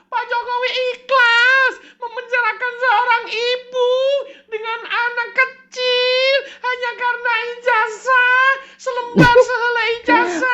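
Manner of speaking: very high, wailing, tearful; hammed-up sobbing appeal to the president; mock crying plea. [name] wails a tearful plea to Pak Jokowi. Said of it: "kenapa kamu nangis".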